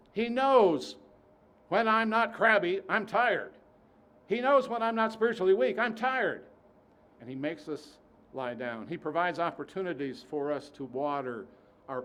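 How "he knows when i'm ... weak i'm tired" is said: loud, higher preaching build; fervent preaching. Acted. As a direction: preach it with rising force. Preaching at full force.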